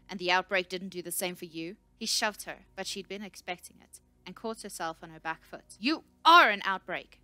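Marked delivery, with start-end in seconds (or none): 0.1-1.8 loud, heated comeback; angry retort. A narrator's reading. Scout answers the shouting researcher, angry retort. 5.8-7.2 very loud, furious scream. A narrator's reading. Researcher caught on her back foot, screaming at the scout. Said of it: "she screamed".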